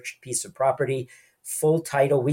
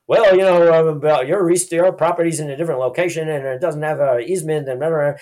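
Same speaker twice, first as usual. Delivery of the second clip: squirming company rep; 2 semitones higher, 3 dB louder than usual. Pretend. Company rep cornered by a client's questions, hammed-up fluster. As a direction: stammer excuses like a caught-out buyer.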